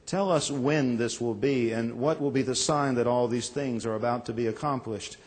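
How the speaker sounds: solemn gospel reading from the pulpit; scripture reading. Acted. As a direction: read the lesson with reverence. Reads the gospel lesson aloud.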